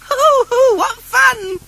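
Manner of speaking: very high, loud hooting giggle; giggling. Acted. The Skittle children giggle as fruit knocks them down, very high. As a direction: squeal with delight. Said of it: "they giggled".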